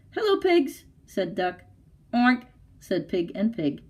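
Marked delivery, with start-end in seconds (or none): 0.1-0.8 much higher, bright greeting as the duck; chirpy little duck saying hello; cheerful duck voice. Acted. 2.1-2.5 pig oink. Acted. Pigs answer duck.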